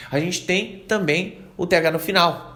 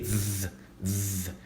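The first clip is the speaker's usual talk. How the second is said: soft low buzzing zz; quiet z drill; buzzing z sound; 7 semitones lower, 9 dB quieter than usual. Shown as a demonstration. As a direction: hold a quiet z buzz. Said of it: "vai parecer um Z".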